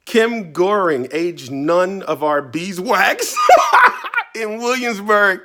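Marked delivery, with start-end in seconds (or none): 1.1-3.4 laughing through the words; slow, laughing read. Real, not acted. Reads joke age, laughing. 3.4-4.3 a high burst of laughter; real high laugh. Real, not acted. Laughs at joke age.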